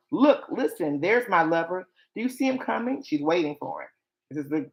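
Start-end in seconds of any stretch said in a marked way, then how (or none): 0.1-3.0 higher, eager reading, pitch leaping on the question; acted excitement. Bride spots her lover coming, excited higher-pitched dramatic reading. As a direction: act out a thrilled sighting.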